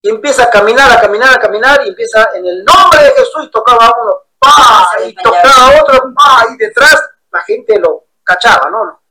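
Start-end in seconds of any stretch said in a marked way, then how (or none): 2.3-3.4 raised, ringing preacher voice; preacher's call. Retold or imitated. Retelling the pastor's healing call, raised preacher cry. Pastor laying hands, shouting. 4.4-4.9 sharp loud "pa" like a palm strike; mock slap sound. Pastor's hand hitting each believer, loud mock slap. 6.1-6.5 sharp loud "pa" like a palm strike; mock slap sound. Pastor's hand hitting each believer, loud mock slap.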